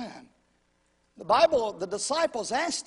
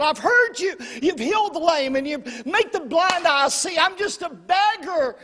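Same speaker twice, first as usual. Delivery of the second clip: much higher and louder, a repeated desperate shout; even louder cry; 9 semitones higher, 9 dB louder than usual. Retold or imitated. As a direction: scream it over the crowd, pleading for mercy again and again. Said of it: "he begins to raise his voice even louder".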